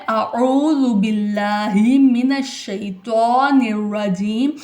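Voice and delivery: अऊज़ु begun with a short अ, slowly recited; अऊज़ु बिल्लाहि recited slowly, short opening अ; correct recitation. Shown as a demonstration. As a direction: model अऊज़ु with a short hamza अ, unhurried. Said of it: "फिर बाद में आइन का मखरिज निकालना है".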